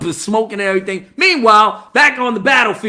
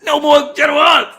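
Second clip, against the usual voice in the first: usual speaking voice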